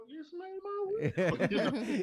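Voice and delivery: hammed-up obedient answer; eager polite reply. Answering a Southern lady with eager manners. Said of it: "And you kinda wanna".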